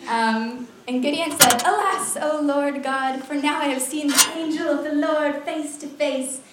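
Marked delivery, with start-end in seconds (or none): 1.7-6.4 high, loud cry of dismay; loud, hammed-up alarm, opening high; dramatic alarmed cry. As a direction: read it as Gideon's overwrought wail to God. Gideon's alarmed cry in scripture reading.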